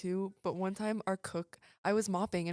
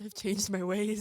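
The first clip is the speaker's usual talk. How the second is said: mix of British and country; 5 dB louder, slower than usual. Pretend. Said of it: "What kind of accent are you doing right now".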